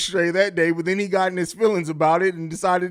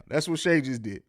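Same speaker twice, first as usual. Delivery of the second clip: usual speaking voice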